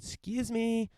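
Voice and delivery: high called-out excuse me; yelled excuse me. Retold or imitated. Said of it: "I yelled".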